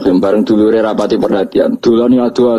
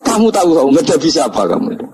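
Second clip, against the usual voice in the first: higher-pitched mock scolding; acted rebuke; 8 semitones higher than usual. Umar snapping back at the grave angels, mock rebuke. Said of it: "Orang nyentak wes disentak sih".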